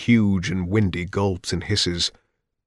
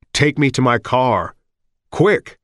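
urgent; 4 semitones higher, 4 dB louder than usual. Acted. Elton urgent as sirens approach.

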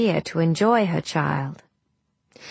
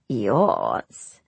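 sneering crone; 9 semitones lower than usual. Acted. Crone mocks the queen.

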